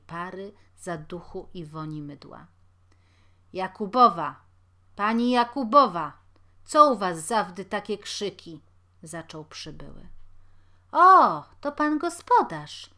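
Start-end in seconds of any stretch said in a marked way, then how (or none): 3.5-8.6 loud call over the din. A narrator's reading. Landlord entering the room, calling the tenant: loud call. 10.9-12.9 sudden loud high exclamation; surprised. A narrator's reading. Tenant seeing the landlord at her door: loud surprised cry. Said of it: "Zdziwiła się".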